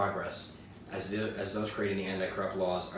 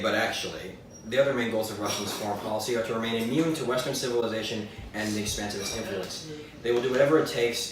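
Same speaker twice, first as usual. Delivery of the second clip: ordinary delivery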